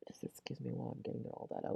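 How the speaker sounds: whispered apology; whispering. Real, not acted. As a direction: whisper it aside, off mic. Apologising while coughing/yawning.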